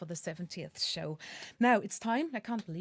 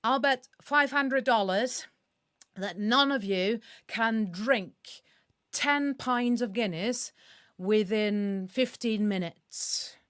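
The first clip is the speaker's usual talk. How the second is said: louder, slow mock American accent, retried; American accent retry; 5 dB louder, slower than usual. American tourist challenging the Dublin locals, in a mock American accent. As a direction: shout the bet again in a hammed-up American accent. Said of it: "he shouts as loud as he can".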